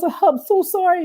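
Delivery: high, drawn-out mock apology; pleading apology. DJ calling her boss to confess, high pleading apology. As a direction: re-enact a slow, high-pitched apology.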